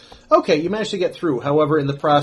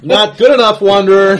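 taunting outlaw; 5 semitones higher, 10 dB louder than usual. Acted.

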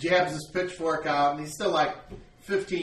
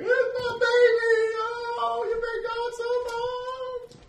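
very high, slow joyful cry; overjoyed old mother; 6 dB louder, slower than usual. Acted. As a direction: greet her long-lost boy. Mother hugging returning son.

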